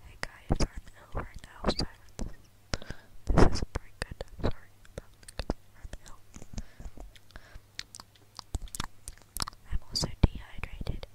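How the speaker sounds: breathy whisper right into the mic; joking ASMR whisper; mock ASMR. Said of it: "I should do an A S M R video".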